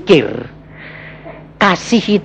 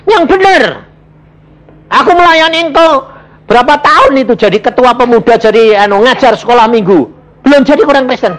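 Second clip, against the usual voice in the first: indignant disbelief; 10 semitones higher than usual. Retold or imitated. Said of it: "Aku bilang".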